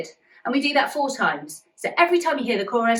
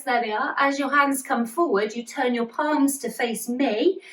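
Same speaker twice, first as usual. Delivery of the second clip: normal talking voice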